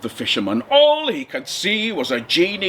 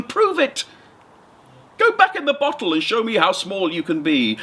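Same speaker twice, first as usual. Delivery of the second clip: higher, nervous fisherman with a laugh; a frightened little man stalling for time, higher; 6 semitones higher than usual. Acted. Fisherman stalling the genie, nervous and higher-pitched.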